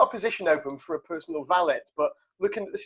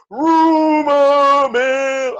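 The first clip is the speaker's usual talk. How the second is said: loud, high, long drawn-out announcement; silly segment voice; 10 semitones higher, 10 dB louder, much slower than usual. Shown as a demonstration. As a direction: stretch each word like a hammed-up show announcer. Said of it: "wants me to do the voice".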